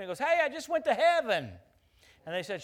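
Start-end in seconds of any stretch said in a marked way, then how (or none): 0.2-1.5 put-on high, loud boy's yell; retold yell of a boy back from heaven; excited scream. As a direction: shout it like he just woke up amazed. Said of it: "starts screaming".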